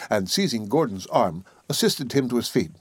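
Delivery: ordinary delivery, nothing marked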